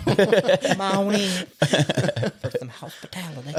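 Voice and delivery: high, drawn-out Southern hospitality twang; Southern accent. Pretend. Friend parodying a Southern host, exaggerated Southern accent. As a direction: stretch out a mock Southern welcome. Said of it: "Scarlet accent or whatever".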